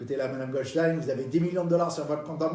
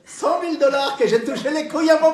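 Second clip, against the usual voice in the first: put-on triumphant old lady; 11 semitones higher, 8 dB louder than usual.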